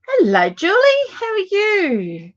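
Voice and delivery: high, loud sing-song hello; excited greeting. Real, not acted. Greeting a viewer joining live.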